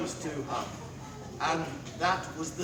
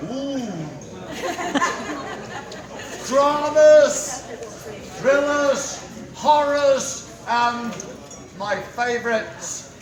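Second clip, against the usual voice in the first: town crier; 5 semitones higher, 6 dB louder, slower than usual. Acted. Town crier announcing event.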